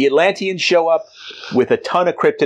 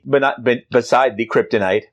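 ordinary delivery

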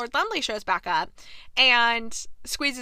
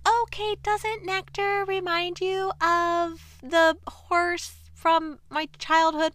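gushing Edwina; 6 semitones higher, slower than usual. Retold or imitated. Edwina gushing about a horse.

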